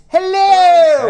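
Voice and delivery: loud high shouted greeting; big shouted hello. Acted. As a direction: belt out the hello. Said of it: "What a hello".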